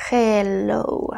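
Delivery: "hello" drawn out slowly; slow, careful teacher's model of "hello"; slow pronunciation demo. Shown as a demonstration. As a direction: stretch "hello" out for learners, h clearly sounded. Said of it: "Ok, de esta manera".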